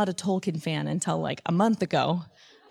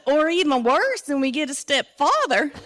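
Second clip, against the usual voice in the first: hammed-up Southern kid, loud and pitched up; Southern accent; 7 semitones higher, 5 dB louder than usual. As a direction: draw out the punchline in Southern. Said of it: "in his Southern accent".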